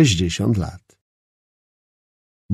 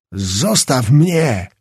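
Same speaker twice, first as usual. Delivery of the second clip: mild shout; 2 semitones higher, 3 dB louder, much slower than usual. A narrator's reading. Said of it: "krzyknął".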